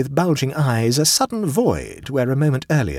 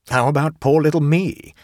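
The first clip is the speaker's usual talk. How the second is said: higher-pitched mock-humble plea; modest; 5 semitones higher, 4 dB louder than usual. A narrator's reading. Angel answering the Duke's teasing, modest. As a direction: put yourself forward with mild modesty. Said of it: "said the angel modestly".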